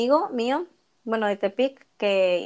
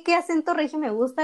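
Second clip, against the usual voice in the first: usual speaking voice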